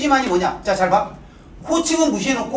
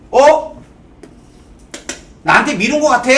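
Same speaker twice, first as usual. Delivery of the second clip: raised, higher mock grumble; acted loud retort; 3 semitones higher, 7 dB louder than usual. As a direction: toss back a loud, playful accusation. Acting out a suspicious reply. (큰 소리로 따지는 척)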